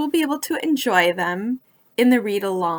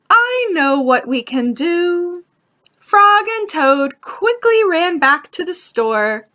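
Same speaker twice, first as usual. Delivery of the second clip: lively storybook narration, rising higher as the story heats up; reading to children; 5 semitones higher, 4 dB louder than usual. A narrator's reading. Librarian reading Frog and Toad to young listeners, animated storybook voice. Reads picture book to children.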